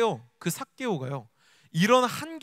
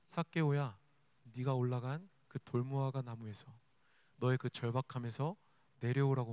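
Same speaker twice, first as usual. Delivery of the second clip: soft, low, tender invitation; gentle Jesus; 8 semitones lower, 10 dB quieter than usual. Acted. Jesus inviting Zacchaeus down from the fig tree, gently. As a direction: speak gently and quietly, with pauses between phrases. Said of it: "예수님은 제안하십니다".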